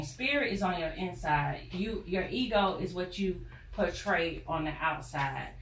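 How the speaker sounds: usual speaking voice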